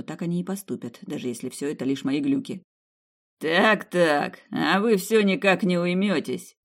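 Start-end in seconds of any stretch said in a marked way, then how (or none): 3.4-6.6 a lightly voiced mocking dark lord; Владис, mocking. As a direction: sneer from everywhere at once. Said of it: "Насмешливый голос раздался отовсюду".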